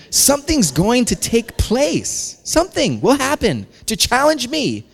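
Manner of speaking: slow, high-pitched theatrical exclamation; hammed-up ominous insistence; dramatic warning. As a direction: swoop high on each phrase, drawn out. Acted mounting alarm at challenge.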